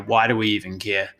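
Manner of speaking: ordinary talk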